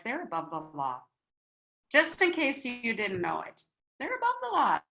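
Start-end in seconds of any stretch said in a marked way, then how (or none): 0.0-1.0 low, soft, confiding statement; low aside. Shown as a demonstration. Telling the guys about young souls, low confidential aside. First, low take of repeated line. 4.0-4.8 pitched way up, pointed repeat; high-pitched emphasis. Shown as a demonstration. In case you didn't know it, high emphatic repeat.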